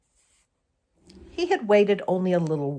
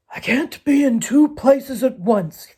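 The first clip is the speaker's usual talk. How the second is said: lightly voiced crafty coyote, a bit higher and louder; a hint of a scheming old coyote thinking it through; sly Coyote musing; 6 semitones higher, 6 dB louder than usual. Coyote plotting to himself, a lightly voiced sly musing.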